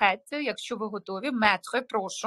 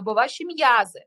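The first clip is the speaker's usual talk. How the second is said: usual speaking voice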